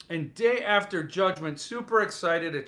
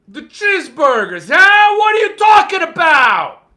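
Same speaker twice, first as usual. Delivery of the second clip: mock King voice; 12 semitones higher, 14 dB louder than usual. King scoffing at the Chizburger joke, loud mock shout. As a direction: rant like the cranky author.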